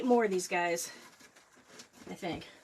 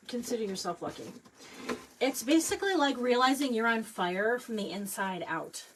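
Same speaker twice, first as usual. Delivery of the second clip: ordinary delivery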